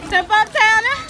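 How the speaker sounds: loud teasing call across the beach. Real, not acted. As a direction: shout to egg a friend on. Calling friend toward waves.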